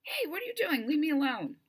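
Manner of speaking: high, annoyed protest at being woken; irritated, half-asleep whine; grumpy. A narrator's reading. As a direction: snap awake and complain. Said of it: "not happily".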